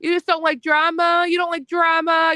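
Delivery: high, sneering repeated question; incredulous anger. Real, not acted. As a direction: throw the question back at her in disbelief. Angry rant at a rival.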